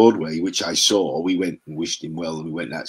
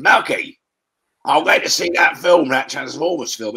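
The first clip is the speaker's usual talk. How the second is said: imitating his mate Ray; 9 semitones higher, 7 dB louder than usual. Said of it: "He said".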